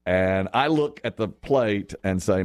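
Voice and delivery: ordinary delivery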